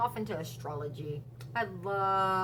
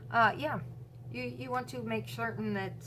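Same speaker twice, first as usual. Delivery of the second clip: ordinary talk, nothing marked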